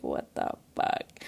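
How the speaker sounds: slow, voiceless imitation of shock; drawn-out whispered disbelief; imitated stunned disbelief. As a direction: mouth it slowly, as a stunned friend. Friend's reaction to the hacked account.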